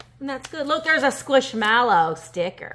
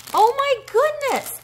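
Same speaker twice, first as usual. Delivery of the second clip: much higher and louder, slow; amazed; 7 dB louder than usual. Real, not acted. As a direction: melt over it with delight. Amazed by gift.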